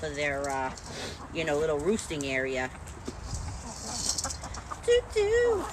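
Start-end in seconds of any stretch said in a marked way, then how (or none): 4.8-5.6 high sing-song toot toot; playful high-pitched chicken call. Real, not acted.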